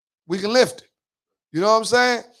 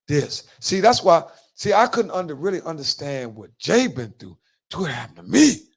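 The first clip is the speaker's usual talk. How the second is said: ordinary talk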